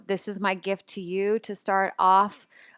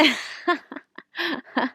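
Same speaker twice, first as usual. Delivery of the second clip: light laugh; 7 semitones higher than usual. Real, not acted. Laughs after showing setup.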